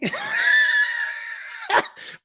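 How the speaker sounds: high squealing laugh; uncontrollable laughing. Retold or imitated. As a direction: act out a long, helpless high laugh. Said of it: "I couldn't stop laughing".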